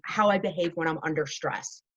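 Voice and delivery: ordinary talk, nothing marked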